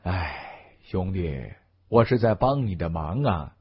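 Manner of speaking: soft, low weary sigh into speech. A narrator's reading. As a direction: sigh it out to a brother, resigned. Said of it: "轩龙叹了口气".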